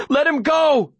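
high-pitched desperate demand; yell. A narrator's reading. Boy to man holding his dog, high desperate yell. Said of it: "I yell".

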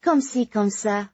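flirty high French voice; coquettish. Acted. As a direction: tease it playfully, accented. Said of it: "Ludivine replied coquettishly".

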